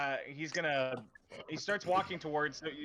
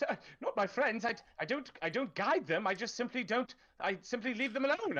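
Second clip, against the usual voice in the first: higher, hesitant official's voice; nervous town official; 8 semitones higher than usual. Acted. Cornered town official stammers excuses to the knife-wielding player, high and nervous. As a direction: fumble excuses like a cornered bureaucrat.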